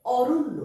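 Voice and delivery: অরণ্য said as "oronno", with a doubled n; loud, careful অরণ্য with a held double n; clear pronunciation demo. Shown as a demonstration. Teacher demoing the word to pupils, careful and clear. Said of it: "মূর্ধন্য নয় জফলা".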